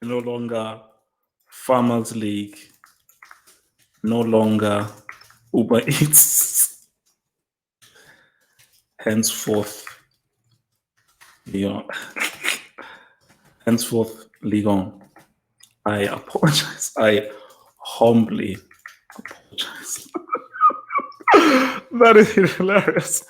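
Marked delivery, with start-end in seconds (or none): 0.0-20.1 low, slow, measured retraction; mock-solemn apology. 21.3-21.9 high, loud burst of laughing; burst of laughter. Real, not acted. Bursting out at the result.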